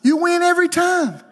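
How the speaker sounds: climbing high to a triumphant finish; rising preaching. Acted. Preacher assuring the church they never lose, building high. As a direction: build to victory.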